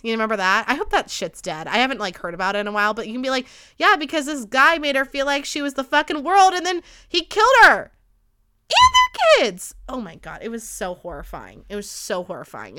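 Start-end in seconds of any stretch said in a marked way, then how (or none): 3.8-9.7 high, loud indignant comeback, building to a shriek; outraged retort. Acted. Imagined angry retort to "not all men. 9.9-12.8 soft, sunken voice of dismay; hushed horror. Real, not acted. Appalled aftermath of telling the killing.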